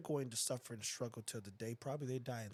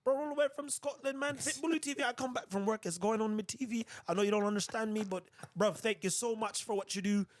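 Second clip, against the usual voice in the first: much higher, louder mock Scottish accent that slides toward London-Jamaican; 9 semitones higher, 5 dB louder than usual. Scottish fan thanking him for the dog videos, in a hammed-up Scottish accent. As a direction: do a Scottish fan's accent that comes out like Top Boy. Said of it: "Sure, an accent".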